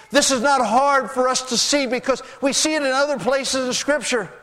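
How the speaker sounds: high, urgent sermon delivery; impassioned preaching. Real, not acted. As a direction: pour it out fervently, pitched high. Preaches at full force.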